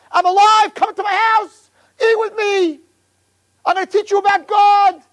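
high, pressing joy; urgent high excitement. Acted. Avraham, fresh from the fire, urging guests in. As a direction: burst out with the thrill of being alive.

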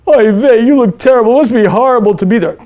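put-on loud, high Yiddish-accented fretting. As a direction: play the fussing Yiddish friend. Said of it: "your friends say".

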